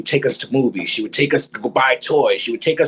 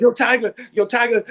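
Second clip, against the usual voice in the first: panicked calling; 8 semitones higher than usual. Retold or imitated. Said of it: "I'm panting".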